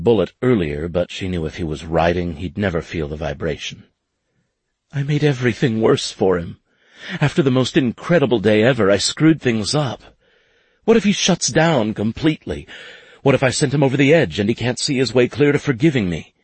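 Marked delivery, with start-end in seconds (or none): 4.9-16.3 distraught. A narrator's reading. Finley distraught over Bullet.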